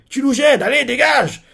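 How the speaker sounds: cri aigu et fort, agacé; chasse-le en criant. Retold or imitated. Ayase chasse le gars. (Ayase crie au gars de dégager, voix haute et forte)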